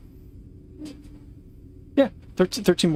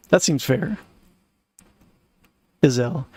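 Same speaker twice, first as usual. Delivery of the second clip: usual speaking voice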